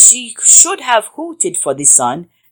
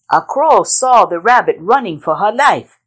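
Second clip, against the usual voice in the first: ordinary delivery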